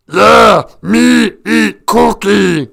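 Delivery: slow, gruff cookie-craving monster. Retold or imitated. Streamer doing Cookie Monster for the chat, slow gruff monster voice. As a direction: grumble it like a hungry puppet monster. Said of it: "Cookie Monster".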